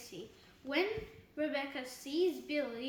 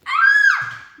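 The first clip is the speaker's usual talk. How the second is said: short, very high, loud scream; 20 dB louder than usual. A narrator's reading. Girl terrified by her nightmare, screaming. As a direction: shriek at full volume. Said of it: "Billy screams again".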